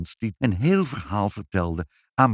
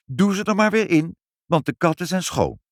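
put-on high-pitched little girl; unrepentant child; 9 semitones higher, 4 dB louder than usual. A narrator's reading. Child quoting her mother before the visitor, unrepentant. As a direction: carry on blithely like a tactless child. Child carries on despite father's order.

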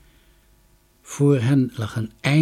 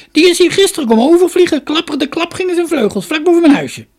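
put-on high, loud cackling old woman; high-pitched crone in full voice; 11 dB louder than usual. A narrator's reading. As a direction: cackle it out like an excited storybook crone. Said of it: "begon te kakelen".

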